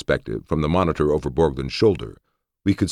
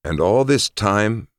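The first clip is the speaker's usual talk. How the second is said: slow, higher wondering question; wondering aloud; 7 semitones higher, slower than usual. A narrator's reading. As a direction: muse it slowly upward. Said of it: "I wondered aloud".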